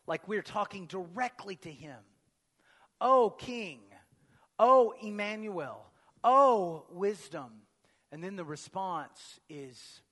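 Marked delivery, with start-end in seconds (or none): 3.0-7.6 loud, slow, exclaimed O calls; emphatic O. Shown as a demonstration. Pastor calls out the antiphon titles to Christ, loud and slow. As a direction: call out each O to the King. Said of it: "It starts emphatically with".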